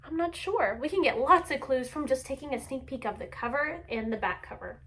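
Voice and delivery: ordinary delivery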